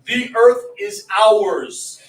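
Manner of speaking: loud, slow, over-enunciated proclamation; mock the stiff bookish phrasing, drawn out loudly; stilted declaration, every word stretched; mock formal speech. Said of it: "we didn't go around".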